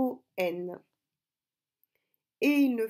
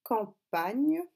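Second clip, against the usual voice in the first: campagne in two separated, louder syllables; 2 semitones higher, 5 dB louder than usual. Shown as a demonstration. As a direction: break campagne into cam, then pagne. Dictating word before writing it. (campagne bien découpé en syllabes)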